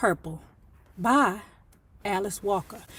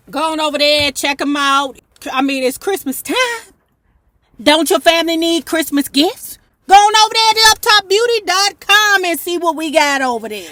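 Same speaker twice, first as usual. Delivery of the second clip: louder, higher, pushing them to the store; hyped sales pitch; 5 semitones higher, 7 dB louder than usual. Real, not acted. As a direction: sell it to the viewers. Pushing viewers to her store.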